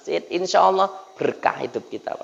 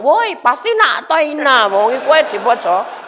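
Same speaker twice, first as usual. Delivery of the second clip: high-pitched mocking kid voice; heckling children; 6 semitones higher, 3 dB louder than usual. Children teasing the imam over his short surah, jeering. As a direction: jeer loudly like children at the back. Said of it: "Anak-anak ngomong".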